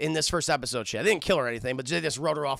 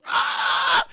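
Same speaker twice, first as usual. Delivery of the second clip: loud breathy shriek; imitated shriek; 7 dB louder than usual. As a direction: hiss out a sudden attacking screech. Possessed woman shrieks, attacks.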